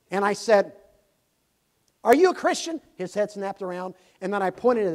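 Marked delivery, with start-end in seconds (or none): 2.0-2.8 sudden loud high challenge; blunt loud question. Retold or imitated. Preacher confronting a stranger at the bus stop, loud and blunt. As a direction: fire the question at him point-blank. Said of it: "I said it just like that".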